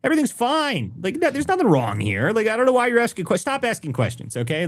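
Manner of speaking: high, smug mock official; sarcastic crook voice. Host mocks a corrupt official shrugging off fraud, high smug voice. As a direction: play the shifty official brushing it off.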